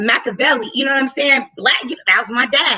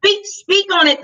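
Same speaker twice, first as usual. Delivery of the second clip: eager urging; 7 semitones higher than usual. Real, not acted. Urging her co-host to tell the story, eager. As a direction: egg him on to tell it.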